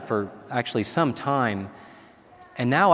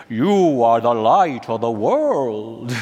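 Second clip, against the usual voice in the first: put-on slow, heavy Polish accent, each word drawn out; a fond impression of the Polish pope, Polish accent stretched slow; a slow, loving Polish-accented blessing on the crowd; Polish accent of John Paul II; 3 semitones lower, 4 dB louder, much slower than usual. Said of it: "In his Polish thick accent".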